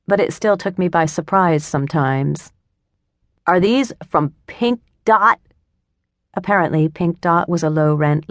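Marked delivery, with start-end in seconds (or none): 3.5-5.4 disgusted. A narrator's reading. Boss disgusted at bagels.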